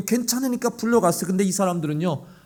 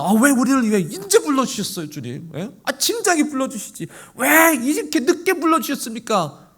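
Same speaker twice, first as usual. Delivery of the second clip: whiny, higher complaint; mock protest; 5 semitones higher than usual. Imagined workers protesting to the Lord, whiny. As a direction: argue back, aggrieved. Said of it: "그렇게 따지지 않았어요".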